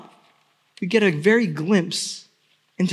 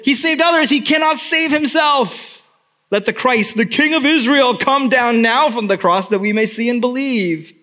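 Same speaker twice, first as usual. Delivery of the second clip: high, louder sneering taunt; mocking priests; 5 semitones higher, 3 dB louder than usual. Priests and scribes taunting Jesus, high sneer. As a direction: sneer each phrase upward. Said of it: "The priests and the scribes said".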